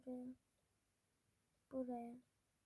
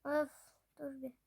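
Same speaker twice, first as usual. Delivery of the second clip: ordinary talk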